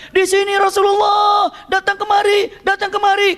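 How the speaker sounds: shouting over the battle noise; calling out. Retold or imitated. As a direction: summon the scattered troops. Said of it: "Rasulullah bilang".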